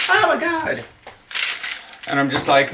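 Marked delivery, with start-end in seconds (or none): 0.1-0.8 much higher mock gasp; mocking gushing fan. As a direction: squeal it like an overexcited fan. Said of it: "people ... think".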